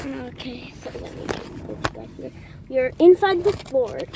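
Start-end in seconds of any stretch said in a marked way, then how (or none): 0.1-2.3 quick breathy whisper. Real, not acted. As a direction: whisper while shuffling in. Settling inside the fort.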